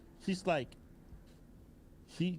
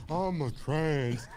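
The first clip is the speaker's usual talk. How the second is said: slow, drawn-out mock feminine voice; hammed-up imitation of a guy; 6 semitones lower, much slower than usual. Mocking to his co-host, a slow put-on voice. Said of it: "a dude is like".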